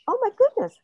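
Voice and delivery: high, wondering exclamation; amazed realization. Retold or imitated. Acting out her own sudden realization.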